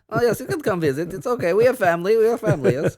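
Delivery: put-on Russian accent for Austria. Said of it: "Russian accents for Austrian".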